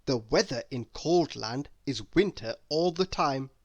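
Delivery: ordinary delivery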